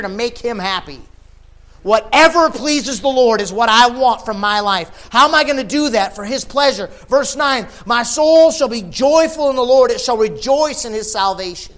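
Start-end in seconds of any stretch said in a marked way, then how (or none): 1.8-11.7 loud, high, impassioned preacher; ringing pulpit delivery at full volume; full-force preaching. Real, not acted. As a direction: preach it out, building to a shout.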